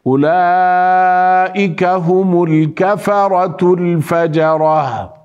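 slow tajweed recitation, الفجرة ending in a light h; recite, letting الفجرة close on a thin h. Shown as a demonstration. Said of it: "نرقق الهاء". (تلاوة مرتلة بترقيق هاء الفجرة)

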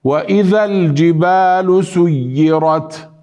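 slow chant, سيرت with moderately doubled y; recite, doubling y in سيرت without strain; correct recitation. Shown as a demonstration. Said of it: "نقول". (تلاوة بتشديد سيرت دون تكلف)

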